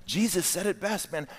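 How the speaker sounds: ordinary talk, nothing marked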